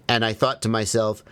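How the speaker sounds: ordinary talk, nothing marked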